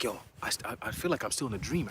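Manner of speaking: low, hushed, stammering wonder; dazed. Retold or imitated. Mom still disbelieving days later, softly dazed. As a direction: imitate mom quietly stunned.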